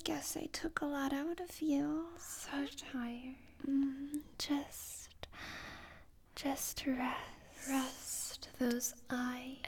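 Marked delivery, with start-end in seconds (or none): 2.2-3.4 soft tender whisper; fussing. Acted. Soothing tired partner to sleep. 7.7-9.6 soft tender whisper; fussing. Acted. Soothing tired partner to sleep.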